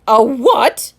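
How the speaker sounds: sharp, stern question; a stern headmaster's demand. A narrator's reading. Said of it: "his voice very stern".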